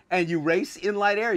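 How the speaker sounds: ordinary talk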